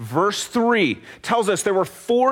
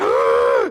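sharp loud gasp waking from a nightmare; startled gasp; 4 dB louder than usual. Shown as a demonstration. Preacher acts a child waking scared at night, sharp gasp. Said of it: "you're just like".

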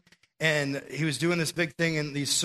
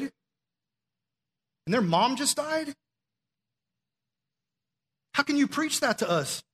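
anguished protest; 9 semitones higher, slower than usual. Retold or imitated. Retold grieving man confronting preacher.

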